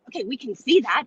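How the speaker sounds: normal talking voice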